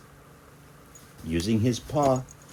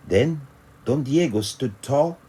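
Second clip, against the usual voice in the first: plain reading voice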